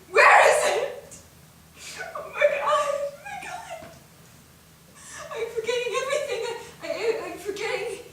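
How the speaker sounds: high, broken, laughing through tears; words tumbling out fast in panic; panicked sobbing. Acted. Breakdown, forgetting everything.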